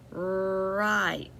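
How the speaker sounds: usual speaking voice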